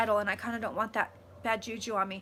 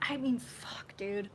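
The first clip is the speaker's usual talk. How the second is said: 1 whispered aside; 7 dB quieter, slower than usual. Real, not acted. Overwhelmed aside.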